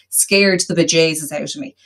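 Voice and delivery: ordinary talk, nothing marked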